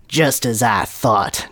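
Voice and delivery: slow, breath-heavy pronouncement; wise surmise. Acted. Dumbledore confirms his suspicion of the atheist, slowly and wisely. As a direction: draw it out like a knowing sage. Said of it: "Dumbledore surmised wisely".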